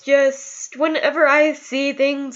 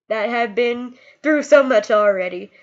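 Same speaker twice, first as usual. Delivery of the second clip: usual speaking voice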